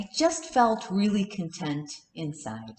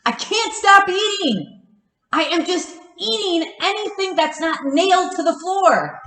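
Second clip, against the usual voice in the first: frantic, higher and louder outburst; 7 semitones higher, 4 dB louder than usual. Retold or imitated. Confessing to her counselor, frantic and louder. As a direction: blurt it out in a rush of panic.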